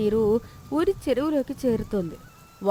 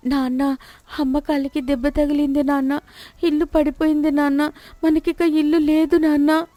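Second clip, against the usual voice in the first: louder, higher pleading sobs; tearful child; 6 semitones higher, 5 dB louder than usual. Acted. Chinni crying to her father about the house, tearful. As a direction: tell father everything is lost.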